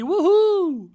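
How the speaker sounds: a high, loud whoop of delight; excited woohoo. Real, not acted. Trader cheering his live stream audience, high excited woohoo. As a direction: whoop like your trade just paid off.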